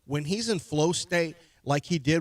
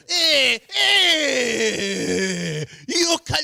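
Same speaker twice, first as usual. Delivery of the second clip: high Argentinian-accented mock complaint; 9 semitones higher, 7 dB louder, much slower than usual. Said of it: "doing an Italian accent".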